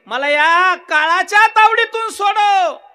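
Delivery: loud pleading cry, rising to a shout; desperate cry. Acted. Caught mouse cries to family to free him, loud. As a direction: yell for rescue from the claws.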